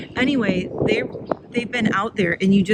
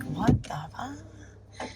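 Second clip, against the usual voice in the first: voicing the dog; 4 semitones higher, 10 dB quieter, much slower than usual. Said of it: "She's like".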